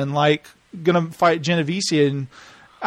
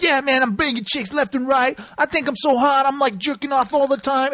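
higher-pitched mock braggart; imitating a cocky young player; cocky bragging guy; 6 semitones higher than usual. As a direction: put on a boastful dude voice. Imitates boastful son in video.

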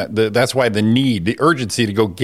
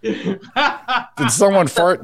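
slow laughing mock question; 8 semitones higher, much slower than usual. Acting out the plane scene.

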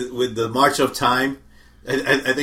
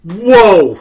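loud, higher, drawn-out whoa; shocked whoa; 5 semitones higher, 9 dB louder than usual. Real, not acted. Co-host hearing the Rolling Stones slighted, shocked exclamation. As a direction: exclaim in disbelief.

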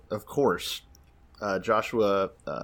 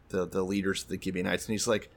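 ordinary delivery

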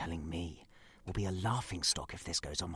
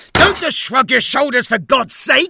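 much louder, higher exploding yell; furious shout; 22 dB louder than usual. Acted. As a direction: lose your temper completely. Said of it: "Particularly thundery summer's day, is it?".

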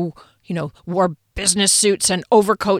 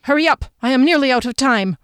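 raised, impatient command; ordering; 5 semitones higher, 4 dB louder, faster than usual. A narrator's reading. Corporal out of time orders Sandra on, impatient command. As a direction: press them urgently to move. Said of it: "Corporal Gorder ordered".

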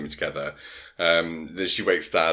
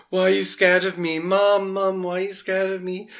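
put-on much higher, a frightened child begging his mum; childish pleading; 5 dB louder than usual. Retelling Peter's scene to the co-host as a high childish plea. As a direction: plead like a small scared boy. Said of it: "it's quite childish as well".

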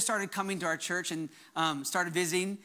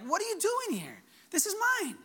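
mock-indignant and much higher; sassy chutzpah; 12 semitones higher than usual. Adam telling the serpent this land is his, sassy and high. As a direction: claim it as yours with playful defiance. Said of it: "a little bit more chutzpah".

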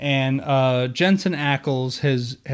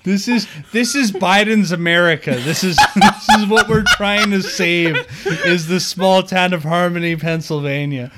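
laughing mock announcement; 5 semitones higher, 5 dB louder than usual. Joking Biden's America, laughing.

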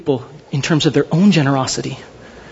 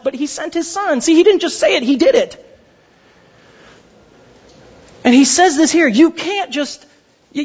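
higher-pitched, pressing preacher's voice; fervent preaching; 6 semitones higher than usual. Acted. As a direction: drive every you can't home to the congregation. Preaching at full force.

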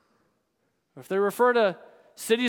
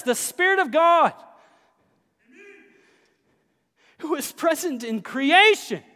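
higher, louder, rousing pulpit voice; building sermon fervor; full-force preaching; 5 semitones higher, 5 dB louder than usual. Acted. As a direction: preach it out to the whole room.

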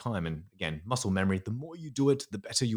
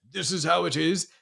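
blunt detective; 8 semitones higher, 4 dB louder than usual. Retold or imitated. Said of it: "detectives who are very".

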